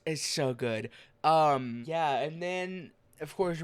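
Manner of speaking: ordinary delivery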